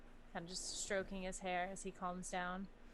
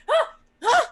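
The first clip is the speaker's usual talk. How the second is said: two sharp high screams of fright; startled screams; 19 dB louder than usual. Acted. Somi waking to an alarm, shrieking loudly. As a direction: shriek twice in sudden panic.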